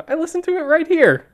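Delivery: laughing. Real, not acted. Laughing reply to teasing.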